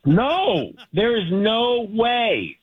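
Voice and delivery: high, slow, exasperated no; exasperated refusal. Real, not acted. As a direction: drag out the refusal in disbelief. Frustrated hotel guest refusing.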